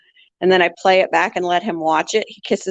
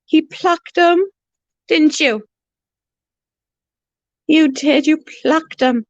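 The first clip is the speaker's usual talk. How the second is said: high teasing pet voice; playful high pet chatter; 9 semitones higher than usual. Real, not acted. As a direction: scold the bird sweetly, pitched up. Talking to bird.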